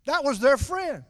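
ordinary talk

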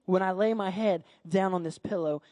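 low, soft, earnest inner monologue; Samuel's quiet resolve. Acted. Boy resolves in his heart, quietly earnest. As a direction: speak inwardly with growing conviction, hushed.

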